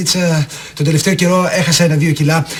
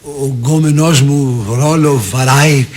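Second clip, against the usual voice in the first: very slow, lower, stretched-out repeat; baffled slow echo; 5 semitones lower, much slower than usual. Acted. Boyfriend repeats Eleni's slang question, slow and baffled. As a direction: draw out each word, puzzling over the slang.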